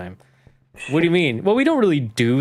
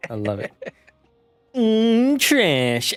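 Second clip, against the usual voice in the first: slow, higher, mock-greedy relish; savoring; 6 semitones higher, much slower than usual. Player joking to Lily about loving trash, slow mock relish. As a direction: drawl out the yum like tasting a treat.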